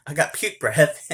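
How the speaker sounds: loud, laughing disbelief; disgusted. Retold or imitated. Refusing the kiss with puke breath, loud and disgusted. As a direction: gross him out, half laughing. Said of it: "I'm like".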